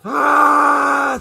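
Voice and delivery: high, loud surprised "Ah"; delighted outcry. Real, not acted. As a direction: burst out in delight. Reacting to viewer's mug.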